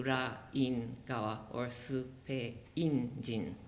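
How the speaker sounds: "Ra-in-Gawa or Supe-in-jin" slowly, broken at each syllable; split syllables. Shown as a demonstration. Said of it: "should be split into".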